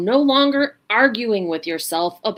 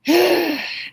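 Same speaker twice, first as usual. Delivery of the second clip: demonstrated sigh; 11 semitones higher, 5 dB louder than usual. Shown as a demonstration. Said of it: "Take a breath".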